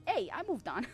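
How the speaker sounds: ordinary talk, nothing marked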